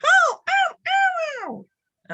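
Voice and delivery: sharp high cries of ow; squealing ows; high yelps. Acted. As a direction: yelp like a hurt puppy. Impression round, yelps.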